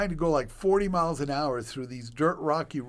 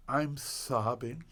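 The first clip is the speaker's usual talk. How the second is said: ordinary talk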